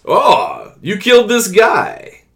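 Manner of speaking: hammed-up villain, high and loud; mock a cackling villain, drawn out and rising; mustache-twirling villain. Critic mimicking the film's war-god villain, ham-fisted and mocking. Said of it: "he shouldn't be mustache twirly".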